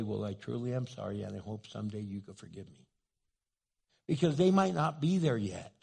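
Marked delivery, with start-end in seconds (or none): 0.0-2.9 low, soft, sincere acceptance; humble apology. Acted. Refused apologizer hoping to be forgiven someday, low humble apology.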